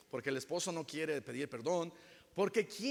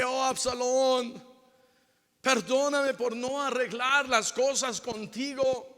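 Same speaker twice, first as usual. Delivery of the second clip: lament; 7 semitones higher, 7 dB louder than usual. Retold or imitated. David laments to Absalom.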